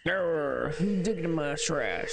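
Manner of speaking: put-on irritated old-timer with a country accent. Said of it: "gave everybody in this town a country accent".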